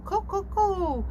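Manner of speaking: high cooing owl sound; owl call. Retold or imitated. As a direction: coo high like a little owl. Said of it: "the little owl that says".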